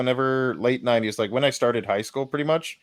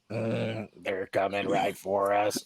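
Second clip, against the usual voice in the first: slow, softer cartoon hunter voice, lightly done; South Park quote; 6 dB quieter, slower than usual. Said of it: "Was it Joe Bob or whatever from, uh, from South Park".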